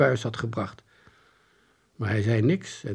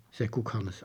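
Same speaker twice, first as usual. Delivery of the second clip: ordinary delivery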